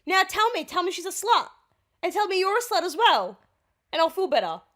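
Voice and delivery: high, loud demanding rant; play-acted angry ultimatum; acted jealous fury. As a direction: lay into your boyfriend in a skit. Acted jealous girlfriend demands admission.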